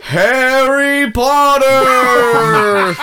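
announcer voice. Pretend. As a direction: bellow the names like a big announcer. Announcing names in big voice.

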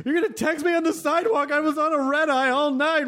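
high-pitched mock-indignant complaint; exasperated guest rant. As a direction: act out a fed-up traveller protesting. Acts exhausted guest ranting at clerk.

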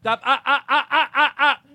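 high, loud scolding like training a dog; laughing mock scold of your own anxiety; yelling at yourself. Shown as a demonstration. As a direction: snap at yourself like you would at a dog. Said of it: "You have to yell at yourself".

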